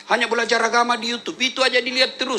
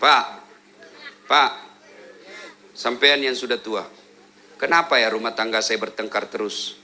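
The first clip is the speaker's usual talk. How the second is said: low, plaintive questioner; complaining congregant; 11 semitones lower than usual. Retold or imitated. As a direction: play the troubled man asking for advice. Congregant asking the ustaz. (suara jemaah yang mengeluh, rendah)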